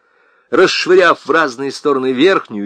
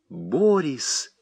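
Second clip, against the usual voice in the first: softer, reproaching; reproachful; 5 dB quieter than usual. A narrator's reading. Wife reproaches her husband. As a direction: say his name in reproach. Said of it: "сказала она с упрёком".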